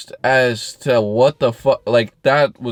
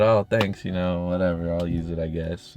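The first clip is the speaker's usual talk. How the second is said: softer, trailing reluctant thanks; unenthused thanks; 9 semitones lower, 6 dB quieter than usual. Retold or imitated. Politely brushing off the neighbor's gift, softer and reluctant. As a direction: mumble it, barely bothering. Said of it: "I said".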